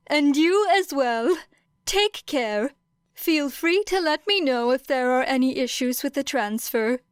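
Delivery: courteous farewell from the teacher; a hint of a gentle older teacher; kindly teacher.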